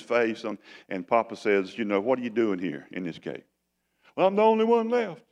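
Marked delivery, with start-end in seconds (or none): 2.0-3.4 soft, gentle and low; a hint of a gentle father. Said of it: "he's real gentle with him". 4.2-5.2 high, put-on whining voice; mock self-pitying moan; whiny self-pity.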